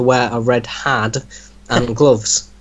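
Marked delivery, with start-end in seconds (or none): none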